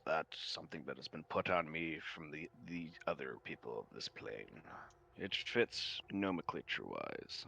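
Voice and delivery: low, soft and measured, a courtly creature; mind vampire voice. Acted. Mind vampire to the artificer, low and composed. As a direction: answer the artificer without offence, calm and low. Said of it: "he goes".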